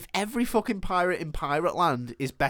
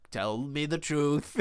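slow, softer put-on accent; Captain Hook; 6 dB quieter, slower than usual. Hook questioning the pirate, in a slow put-on accent. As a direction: draw out an odd captain's accent. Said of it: "what is this accent he's got?".

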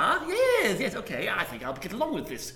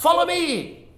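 rallying call; 13 semitones higher, 7 dB louder, much slower than usual. Acted. Calling the party to set off.

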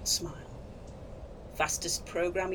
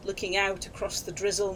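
ordinary delivery

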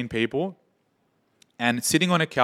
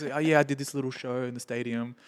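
softer, offhand brag; casual, not caring; 2 semitones higher, 6 dB quieter than usual. Retold or imitated. Imitating the Daft Punk guy, offhand and softer. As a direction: toss it off like it's nothing. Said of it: "that was just normal for him".

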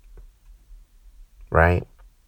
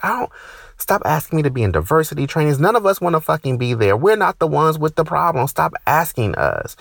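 rising rant; 7 semitones higher than usual. Real, not acted. Ranting at diversity requests.

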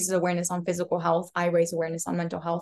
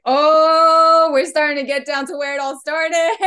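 high, much louder sudden discovery; excited realization; 6 semitones higher, 8 dB louder than usual. Retold or imitated. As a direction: blurt out the big breakthrough. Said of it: "I was like".

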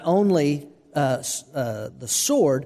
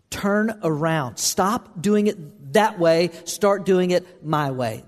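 very slow, each word hammered; stern preacher slowing to insist; slow emphatic command; 3 semitones higher, much slower than usual. Acted. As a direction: drive home the command deliberately.

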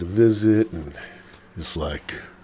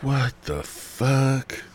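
slow, stretched what the fuck; drawn-out disbelief; 7 semitones higher, much slower than usual. Retold or imitated. Recalling his reaction to her excuses, slow and stretched out. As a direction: drag out each word, incredulous.

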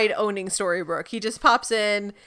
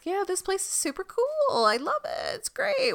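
higher, excited, gushing; gushing Jekyll; 10 semitones higher, 4 dB quieter than usual. Retold or imitated. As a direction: rave about the town like an overeager fan. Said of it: "and he's like".

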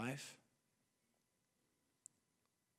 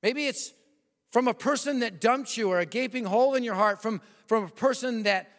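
fervent preaching; 5 semitones higher than usual. Acted. Preacher urging the congregation to name their giant, fervent. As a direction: drive it home to the congregation.

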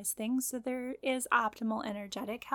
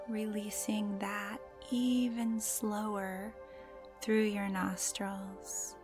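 guided meditation; slower than usual. Acted. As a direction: gently guide the listener into calm.

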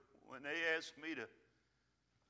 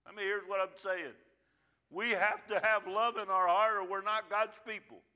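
rising into full-force preaching, louder by the end; building preach; 4 semitones higher, 6 dB louder than usual. Acted. Pastor urging his church to love people, preaching full force. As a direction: push the congregation hard on love.

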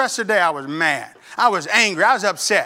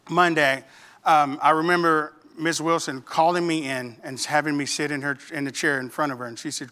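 usual speaking voice

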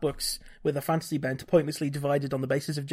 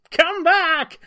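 high, loud desperate shout; villain yelling after his followers; 12 semitones higher, 8 dB louder than usual. Acted. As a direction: yell after fleeing men. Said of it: "he shouts uselessly".

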